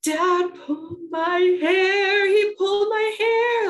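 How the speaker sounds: high, loud, drawn-out mock wail; hammed-up sobbing kid voice, slow and high; dramatic sobbing. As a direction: imitate a child wailing her complaint. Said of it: "like, real dramatic and sobbing".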